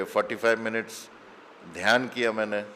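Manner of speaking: usual speaking voice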